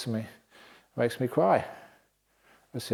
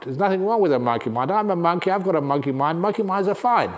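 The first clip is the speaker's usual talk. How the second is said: indignant monkey complaining; 4 semitones higher than usual. Acted. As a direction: rant like a monkey whose pride is hurt. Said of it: "and he complained, said".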